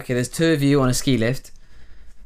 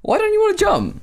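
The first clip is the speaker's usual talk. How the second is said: incredulous high question; 3 dB louder than usual. Acted. Heated mock argument over jumping.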